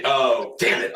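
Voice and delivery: normal talking voice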